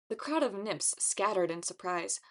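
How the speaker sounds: plain reading voice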